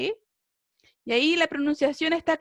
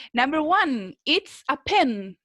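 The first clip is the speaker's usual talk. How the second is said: slow, deliberate reading of the example; slow reading aloud; slower than usual. Shown as a demonstration. Teacher reading the first exercise slowly to students. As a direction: read the example out slowly for learners. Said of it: "lo voy a leer yo".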